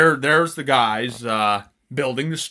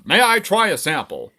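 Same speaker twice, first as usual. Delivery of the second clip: higher, louder acted customer; customer's polite request; 7 semitones higher, 4 dB louder than usual. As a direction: ask as an eager comic shopper. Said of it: "There's a customer who says".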